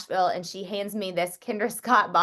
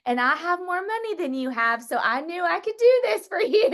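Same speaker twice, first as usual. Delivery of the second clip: high, lightly voiced older lady, affectionate; sweet older lady; 10 semitones higher than usual. Affectionate lightly voiced elder. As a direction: Mo calling Dana up on stage, sweetly. Mo jokes about her money.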